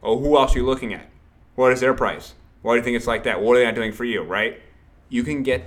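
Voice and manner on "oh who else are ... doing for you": fast and louder, firing questions at the client; hyped-up sales rep steamrolling a client; pushy rapid-fire demo. Shown as a demonstration. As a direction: pummel the client with quick questions. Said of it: "Instead of this".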